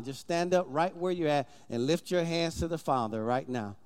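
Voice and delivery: low, quiet altar call. Acted. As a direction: call them gently to their feet. Pastor calls congregation to stand.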